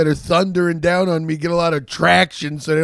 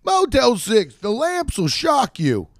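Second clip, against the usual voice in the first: clickbait video narrator; 8 semitones higher than usual. Acted. Mock ad slogan, high.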